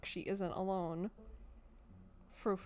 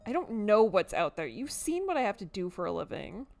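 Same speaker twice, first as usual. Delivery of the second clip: mildly exasperated, raised voice; testy, defensive; 3 semitones higher, 8 dB louder than usual. Acted. Woman arguing about creatures in her garden, testy. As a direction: defend yourself with some force.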